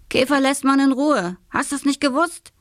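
pointed, scolding question; reprimand. A narrator's reading. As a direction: lecture the younger boy firmly. Big brother reprimands the boy.

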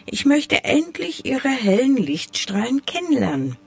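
high, yearning snowflake voice; small eager character voice; eager little snowflake. A narrator's reading. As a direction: lift the voice high with longing.

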